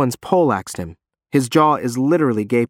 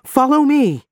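high, loud command; yell; 14 semitones higher, 4 dB louder than usual. Acted. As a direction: shout the order. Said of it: "I yell at Sam".

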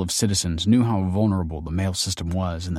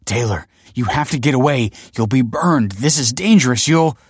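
raised, urgent warning, breaking off; faltering plea; 5 semitones higher, 6 dB louder than usual. A narrator's reading. Man warning friend she'll be burned, urgent plea faltering. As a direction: plead urgently, then falter mid-sentence. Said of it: "he faltered".

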